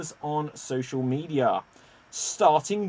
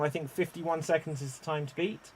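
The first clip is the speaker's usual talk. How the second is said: ordinary talk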